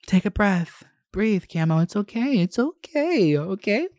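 high, cooing reassurance; mock self-soothing. Creator calming self after a rant, high mock soothing. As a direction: coax yourself down in a high, sweet little voice.

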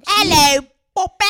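loud, high Cockney greeting; Cockney accent. Retold or imitated. Sister showing off her Cockney impression, loud and high. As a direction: belt it out in hammy Cockney. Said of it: "I can do Cockney".

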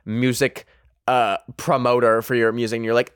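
normal talking voice

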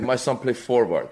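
put-on accented dad repeating his demand; dad's broken English. Dad presses the coach in accented English. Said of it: "He's like".

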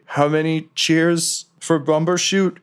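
a sentry calling the password from the fog; guard's challenge. Acted. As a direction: call out the passcode challenge slowly. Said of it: "they'll say".

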